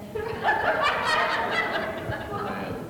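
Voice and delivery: a high burst of laughter at his own joke; cracking up at the mock voice. Real, not acted.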